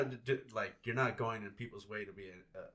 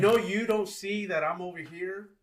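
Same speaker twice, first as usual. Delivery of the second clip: calling out; 7 semitones higher, 7 dB louder than usual. Retold or imitated. Quoting himself at blocking skater.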